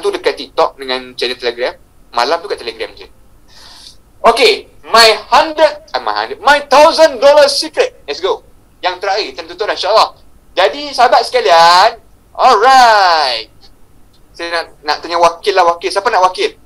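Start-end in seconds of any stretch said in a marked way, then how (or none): 4.3-7.9 loud, high, stretched-out showman delivery; high-energy emcee build; hyped-up announcement. Pretend. Hyping up the closing reveal. 10.6-13.5 loud, raised emcee shout; high, loud, drawn-out hype; rallying call. Real, not acted. Rallying the audience to close.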